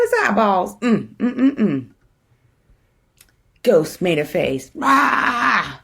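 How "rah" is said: playful monster rah; mock scary roar. Ghost pulling a face at skeleton, mock roar. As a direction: growl it to fake a scare.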